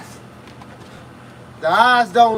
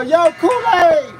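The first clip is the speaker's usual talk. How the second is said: high, drawn-out calling yell; nostalgic yell; 7 semitones higher, 3 dB louder, much slower than usual. Retold or imitated. Boxer recalls calling for Kool-Aid back in the day, yelling. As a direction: holler it slowly, stretching the last syllable. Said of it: "we used to yell".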